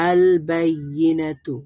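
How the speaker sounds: "আল বাইয়িনাতু" slowly with a sounded l in al; syllable by syllable, l clear in "আল বাইয়িনাতু"; lam pronounced. Shown as a demonstration. Teacher models reading for learners, lam pronounced. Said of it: "তার মানে লাম উচ্চারণ হবে".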